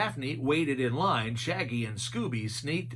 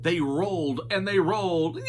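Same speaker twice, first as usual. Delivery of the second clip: usual speaking voice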